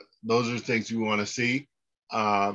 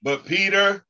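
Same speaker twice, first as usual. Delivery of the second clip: higher, louder lead line for the class to echo; call and response lead; 7 semitones higher, 3 dB louder than usual. Shown as a demonstration. Teacher leading students in call and response, raised voice. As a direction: say it out so they can repeat. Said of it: "repeat after me".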